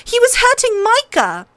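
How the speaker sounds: insist, near shouting; loud protest. A narrator's reading. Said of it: "Arya said loudly".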